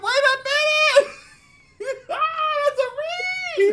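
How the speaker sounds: very high laughing shout; shrieking laughter. Real, not acted. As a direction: scream it out through laughter. Screaming with laughter at read.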